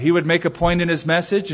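ordinary talk, nothing marked

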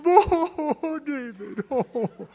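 hammed-up weeping king pleading with David; mock weeping. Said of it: "Saul lifted up his voice and wept".

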